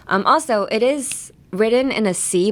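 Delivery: ordinary talk, nothing marked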